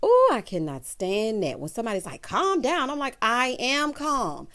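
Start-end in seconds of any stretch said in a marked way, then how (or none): 2.2-2.9 mocking "calm down". Imitating someone saying calm down. 3.2-4.5 slow insistence. Retold or imitated. Her own slow reply.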